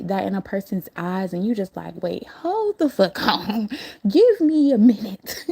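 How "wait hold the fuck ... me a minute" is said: higher and louder, laughing through a nervous stall; half-laughing, stalling the creep off; laughing alarm. Retold or imitated. To the creepy guy at the door, laughing and louder.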